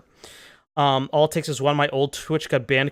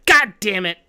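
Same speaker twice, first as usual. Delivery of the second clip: louder, higher burst of real frustration; frustrated curse; 4 semitones higher, 6 dB louder than usual. Real, not acted. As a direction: curse it out loud, exasperated.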